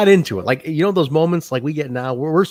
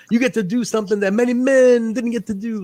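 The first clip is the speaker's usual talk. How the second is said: a touch higher, retold Dusty promo; imitating Dusty; 9 semitones higher than usual. Retelling Dusty's promo to a champion, lightly imitated. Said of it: "He goes".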